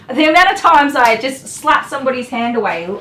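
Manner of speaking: usual speaking voice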